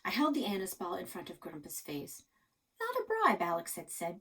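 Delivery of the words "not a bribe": put-on kid voice. Kid voiced, sweet high.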